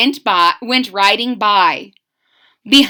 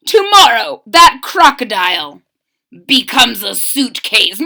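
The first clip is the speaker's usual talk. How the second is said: hammed-up bossy villain; 3 semitones higher, 9 dB louder than usual. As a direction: bark orders like a spoiled bad guy. Villain boasting to butler.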